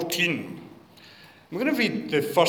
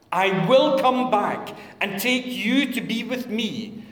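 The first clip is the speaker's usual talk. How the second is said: raised, louder public reading of the Gospel; solemn Bible reading, projected; scripture reading; 4 semitones higher, 6 dB louder than usual. Acted. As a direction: read it out to the congregation with weight.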